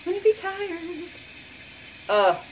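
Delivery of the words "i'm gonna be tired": high mock whine. Real, not acted. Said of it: "Now I'm gonna be tired".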